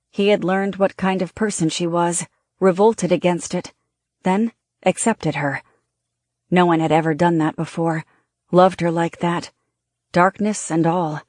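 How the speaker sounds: usual speaking voice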